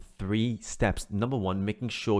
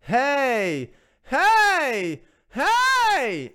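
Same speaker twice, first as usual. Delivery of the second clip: high, loud call of hey climbing each time; loud climbing hey calls to find high notes; 8 dB louder, much slower than usual. Shown as a demonstration. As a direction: call out hey like hailing someone across a street. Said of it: "calling out to people on a hey".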